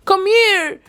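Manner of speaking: high mocking impression of a wife summoning her husband; imitating Dalia. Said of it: "She was like".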